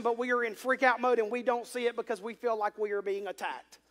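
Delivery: usual speaking voice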